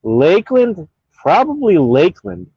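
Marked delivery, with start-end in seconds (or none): none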